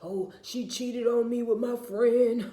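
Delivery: hammed-up betrayed lover; mock wounded man. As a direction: wail it like an aggrieved boyfriend. Mocking a man's complaint about ex.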